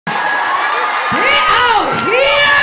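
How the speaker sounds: slow, high shouted question; excited shout. Real, not acted. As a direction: stretch it out in delight.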